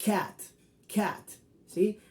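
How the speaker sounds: cat said with a short open a; English cat. Shown as a demonstration. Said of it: "el sonido es A". (cat con a abierta y corta)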